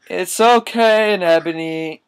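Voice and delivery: high, loud, drawn-out reassurance; hammed-up comforting. Draco consoling crying Ebony, hammed-up high voice. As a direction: croon it in an exaggerated high voice.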